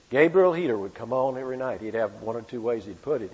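normal talking voice

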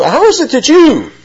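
you thrown up high in disbelief; stress on you. Retold or imitated. Crowd challenging Jesus, high emphasis on you. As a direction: point the word you hard at him. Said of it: "emphatic pronoun".

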